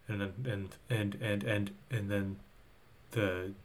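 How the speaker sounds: quiet, halting search for words; stumbling stutter. Real, not acted. Losing the thread of the recap, stumbling stutter. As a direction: get stuck on the joining word, low and hesitant. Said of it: "Is that your stutter?".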